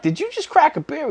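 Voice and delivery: high, shocked retold question; can't believe what he sees; high incredulous question to the friend; disbelieving question. Friend opens beer in car.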